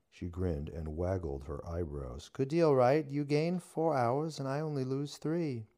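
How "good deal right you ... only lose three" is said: high, loud bargaining witch. A narrator's reading. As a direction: pitch up and sell the deal. Witch voice held.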